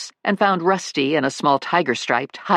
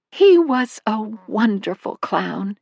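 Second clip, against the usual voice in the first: fond; 8 semitones higher, slower than usual. Acted. Fond mother recollection.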